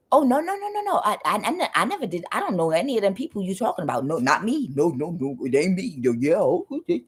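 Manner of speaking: flustered denial. Retold or imitated. Acting a flustered liar's denial.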